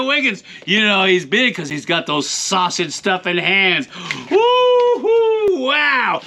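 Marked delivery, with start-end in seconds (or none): 4.3-5.6 high whooping cheer. Acted. Cowboy cheer.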